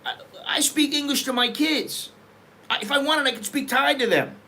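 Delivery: ordinary talk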